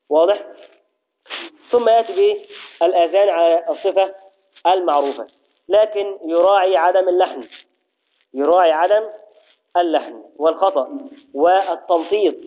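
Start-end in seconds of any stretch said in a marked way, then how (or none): none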